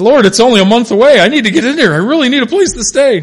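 loud, high, almost crying plea; desperate pleading. Retold or imitated. A preacher retelling how he begged God for a house, loud and near tears. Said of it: "I said".

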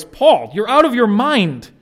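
Festus bellowing at Paul across the court; loud voice. Retold or imitated. Governor shouting that Paul is mad. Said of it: "Festus said with a loud voice".

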